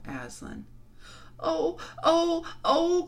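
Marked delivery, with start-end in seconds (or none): 1.4-3.0 high, loud wailing "oh"s; girls' anguished outcry; distressed cry. A narrator's reading. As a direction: cry out in shock and grief. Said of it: "cried the two girls".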